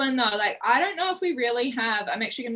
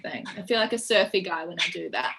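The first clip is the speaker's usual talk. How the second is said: normal talking voice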